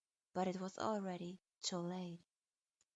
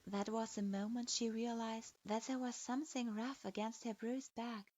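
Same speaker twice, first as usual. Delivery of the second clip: plain reading voice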